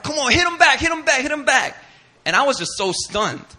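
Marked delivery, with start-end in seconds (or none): 0.0-1.8 high, loud chanting shout; loud, high-pitched urging; crowd egging on. Retold or imitated. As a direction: imitate kids goading a fighter.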